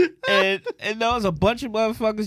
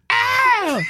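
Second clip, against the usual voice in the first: sudden loud high yelp of pain; shouted ow; 7 dB louder than usual. Retold or imitated. Woman hit by his truck yelling out, high and loud. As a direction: yelp like you just got hit.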